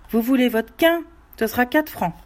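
name his price flatly; gruff well-digger. Acted. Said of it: "il prononça".

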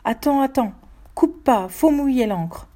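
plus haut, lancé pour retenir quelqu'un; crie-le, en traînant sur la fin; cri pressant. A narrator's reading. Frère crie pour retenir le couteau. (cri inquiet pour arrêter un geste)

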